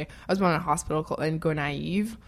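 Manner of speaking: normal talking voice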